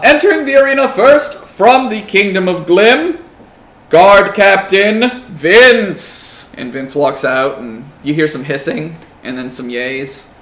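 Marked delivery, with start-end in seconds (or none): 0.0-6.5 loud, drawn-out arena introduction; ring announcer. Acted. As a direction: boom it out to the crowd.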